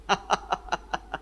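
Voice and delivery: soft laugh. Real, not acted. Teacher laughs at unanswered question.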